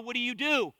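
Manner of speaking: ordinary talk, nothing marked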